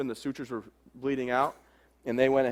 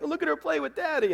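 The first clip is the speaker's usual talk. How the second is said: much higher, sing-song imitation of the cooing moms; 14 semitones higher than usual. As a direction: gush over the baby like a doting grandmother. Mom and wife coo at baby.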